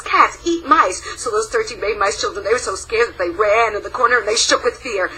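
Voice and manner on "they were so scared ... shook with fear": ordinary delivery, nothing marked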